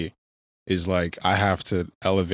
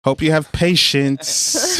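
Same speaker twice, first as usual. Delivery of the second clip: mock taunting; 9 semitones higher, 6 dB louder, slower than usual.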